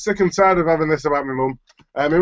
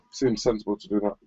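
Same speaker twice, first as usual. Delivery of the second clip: usual speaking voice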